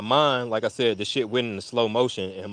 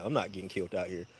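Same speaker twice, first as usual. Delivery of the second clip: quiet aside; 3 semitones lower, 11 dB quieter, faster than usual. Retold or imitated. Fearful inner thought.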